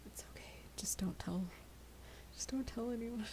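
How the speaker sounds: hushed secret whisper. Real, not acted. Secretive aside to chat.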